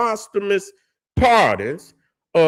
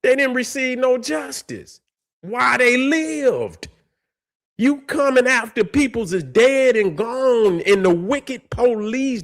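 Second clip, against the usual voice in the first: raised, indignant preaching about wicked police; heated rant; 7 semitones higher than usual. Real, not acted. A man venting at the descendants of wicked police, heated and high. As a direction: pitch climbs high as the anger spills over. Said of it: "Man, I'm so heated".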